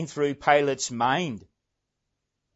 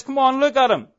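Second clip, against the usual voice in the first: higher, louder Pilate urging the crowd; pleading Pilate; 4 semitones higher, 4 dB louder than usual. Acted. Preacher voicing Pilate urging the crowd, higher and louder. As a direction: coax the mob to see how pathetic he looks. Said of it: "Pilate says".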